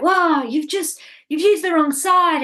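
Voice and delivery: a hint of a worked-up father; exasperated dad. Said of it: "And he was like".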